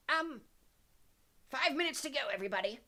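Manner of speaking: high, hesitant professor voice; feeble. A narrator's reading. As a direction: announce it uncertainly after a shocked pause. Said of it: "voice said feebly".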